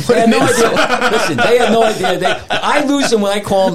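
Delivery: chuckling while he explains; real laughter breaking in; laughing. Real, not acted. Laughing at swimmers.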